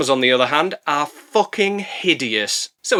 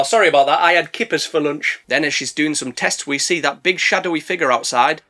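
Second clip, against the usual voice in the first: ordinary talk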